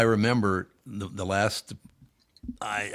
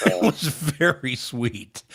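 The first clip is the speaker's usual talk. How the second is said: laughing; 6 semitones higher, slower than usual. Real, not acted.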